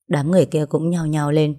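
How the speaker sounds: plain reading voice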